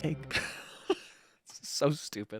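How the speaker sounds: usual speaking voice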